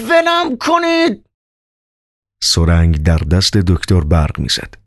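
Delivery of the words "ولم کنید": much higher, slow desperate scream; shrieking. Acted. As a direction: scream ولم کنید, stretching each word. Held by nurses, screaming. («ولم کنید» با جیغ کشیده و بلند)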